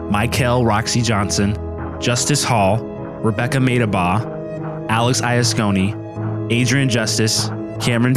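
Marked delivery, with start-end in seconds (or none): none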